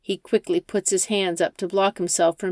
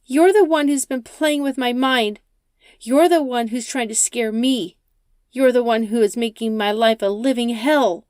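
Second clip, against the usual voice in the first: higher, louder anguished accusation; crying out; 5 semitones higher, 4 dB louder than usual. Acted. Rachel cries out at the driver, blaming him, anguished. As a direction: hurl the blame at him. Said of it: "Rachel cries out".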